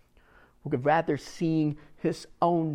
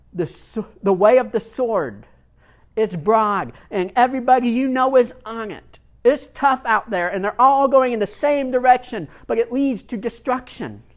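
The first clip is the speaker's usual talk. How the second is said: louder, higher warning about the broad way; fiery preaching; 8 semitones higher, 8 dB louder than usual. Real, not acted. Pastor warning the congregation about the way of the sword, fired up. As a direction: preach it hard, sound the alarm.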